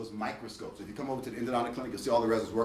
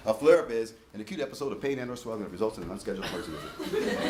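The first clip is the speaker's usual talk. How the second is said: rapid-fire definition. Shown as a demonstration. Lecturer showing off how fast she can recite the definition, rushed. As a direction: rattle it off at top speed. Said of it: "I'm gonna say this real fast".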